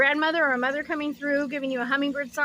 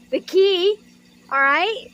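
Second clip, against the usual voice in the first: excited; 5 semitones higher, 7 dB louder, slower than usual. Real, not acted. Excited card discovery.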